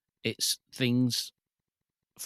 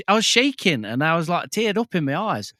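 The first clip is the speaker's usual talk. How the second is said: excited; 7 semitones higher, 4 dB louder than usual. Real, not acted. Recalling finding the figure.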